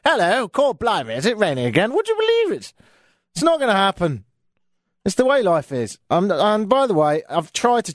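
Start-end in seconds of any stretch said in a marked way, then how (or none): 0.1-2.7 hammed-up London Cockney, last line much higher; mock London accent. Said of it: "you wouldn't instantly go".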